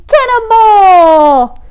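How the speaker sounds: loud, high, long-held cannonball yell; exuberant call. A narrator's reading. Tacky dives before the hunters, yelling exuberantly. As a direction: stretch it out as you leap. Narrator acts out Tacky's splashy dive.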